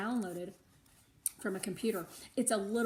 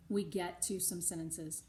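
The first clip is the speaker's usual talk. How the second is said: usual speaking voice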